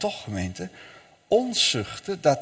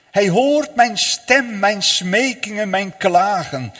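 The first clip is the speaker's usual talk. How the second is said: higher, louder, slow pleading; fervent plea; 6 semitones higher, 6 dB louder than usual. Acted. Preacher voicing the soul's pleas to Christ, loud and slow. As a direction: cry out each plea in turn.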